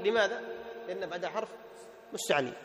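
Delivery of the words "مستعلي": usual speaking voice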